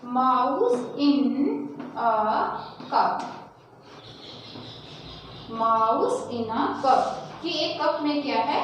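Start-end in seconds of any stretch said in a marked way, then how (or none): 0.1-7.0 very slow, word by word dictation. Shown as a demonstration. Teacher dictating a sentence for pupils to write, slow. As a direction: say it slowly so they can write. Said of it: "अब लिखते हैं कुछ sentence".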